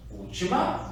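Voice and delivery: ordinary talk, nothing marked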